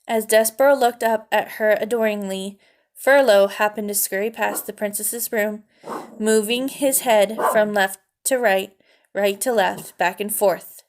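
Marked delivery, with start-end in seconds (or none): none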